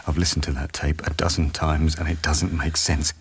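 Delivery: normal talking voice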